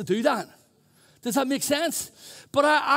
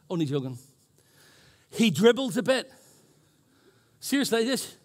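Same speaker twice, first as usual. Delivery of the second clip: ordinary delivery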